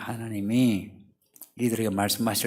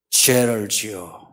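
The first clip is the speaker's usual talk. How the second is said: ordinary talk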